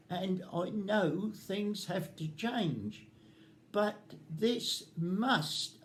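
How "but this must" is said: must hit hard and drawn out slowly; slow, weighted "must" as a warning; stress on must. Real, not acted. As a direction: lean heavily on "must". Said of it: "and I emphasize the word must".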